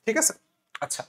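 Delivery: ordinary delivery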